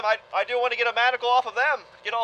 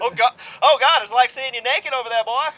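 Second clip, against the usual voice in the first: hammed-up Southern reverend voice, louder; booming mock reverend accent; mock preacher accent; 3 dB louder than usual. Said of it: "my ridiculous accents".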